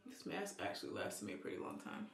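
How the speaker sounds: soft unvoiced whisper. Real, not acted. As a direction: whisper it fast and close. Whispering while applying mask.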